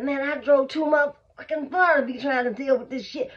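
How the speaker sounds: angry buyer. Retold or imitated. Buyer yelling at seller.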